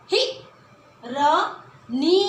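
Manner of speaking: हिरनी very slow, short ही, rising; slow modelled word. Shown as a demonstration. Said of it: "अंदर की आवाज". (छोटी ही के साथ धीरे हिरनी)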